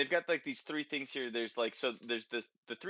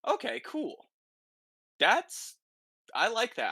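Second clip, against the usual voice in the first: excited; 11 semitones higher, 6 dB louder than usual. Real, not acted. Excited reaction to Reaper build.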